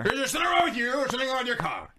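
put-on fast shouted grumpy old man; very old faint voice. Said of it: "Let's do it over again".

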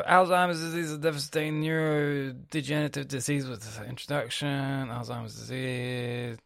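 reading a search result aloud. Acted. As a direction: read the snippet off the screen. Reads an article aloud.

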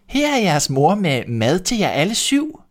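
high put-on mother voice; wolf squeaking sweetly at the door; wolf faking mother. A narrator's reading. Wolf pretending to be mother, high and sweet. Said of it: "sagde den".

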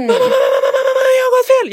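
put-on high, loud, crying wail of a lost kid; panicked cry. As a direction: mimic her son crying down the phone in a panic. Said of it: "ringer han i panik".

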